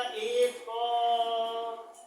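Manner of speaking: "एक क" said slowly, the final vowel held long; drawn-out pronunciation. Shown as a demonstration. Teacher showing the class which vowel ends the word, drawn-out pronunciation of "एक क". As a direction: stretch out the end of "एक क" to expose its vowel. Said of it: "इसका उच्चारण करेंगे".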